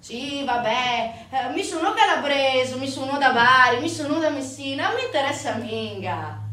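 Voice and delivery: loud, hammed-up Milanese dialect voice; dismissive Milanese lady. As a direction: put on a Milanese accent and brush it off loudly. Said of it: "una signora di Milano potrebbe farmi la stessa domanda".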